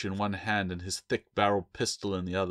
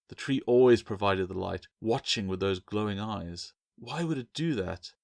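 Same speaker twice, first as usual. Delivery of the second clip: ordinary delivery, nothing marked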